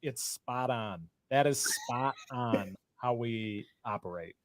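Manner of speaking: awed and quiet. Real, not acted. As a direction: let it sink in, half to yourself. Husband stunned by accurate profile.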